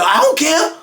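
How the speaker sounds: high-pitched mock yell; retold screaming, voice shot way up; imitated scream. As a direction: yell it defiantly without much added volume. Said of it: "So he scream on him".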